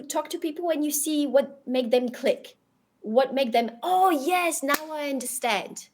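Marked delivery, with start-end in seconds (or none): 3.8-5.9 sudden realization. Retold or imitated. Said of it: "Like, that reaction".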